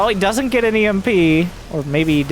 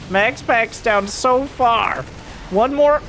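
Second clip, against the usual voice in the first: rising, hyped play-by-play call; excited; 6 semitones higher, slower than usual. Real, not acted. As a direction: build the tension to the finish. Climax of the final fight.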